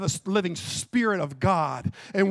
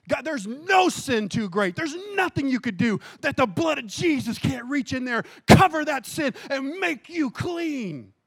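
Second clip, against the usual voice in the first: raised, drawn-out gospel proclamation; fervent preaching; 8 semitones higher, 5 dB louder than usual. Acted. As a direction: preach forgiveness high and forcefully. Preaches at full force.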